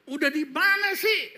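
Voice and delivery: high, loud mock impatient demand. Wife phoning husband on his way home, impatient. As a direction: imitate a pestering caller, pitch shooting up. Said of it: "Dia nggak sabar".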